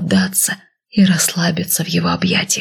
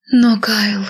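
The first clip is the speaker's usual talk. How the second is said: higher pitiful plea; 4 semitones higher than usual. A narrator's reading. Wife pleads with her husband Kyle, pitiful and high. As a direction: plead weakly, voice lifted. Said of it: "мой голос звучал жалко".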